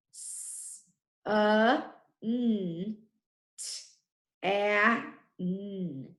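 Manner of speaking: "S-, uh, n. T-, a-, n." as six separate phonics sounds; segmented "S-, uh, n. T-, a-, n."; sounded out. Shown as a demonstration. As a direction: tap through "S-, uh, n. T-, a-, n." one sound at a time. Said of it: "First syllable".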